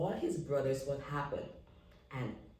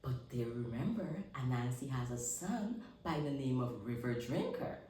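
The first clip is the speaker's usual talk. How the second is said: ordinary delivery, nothing marked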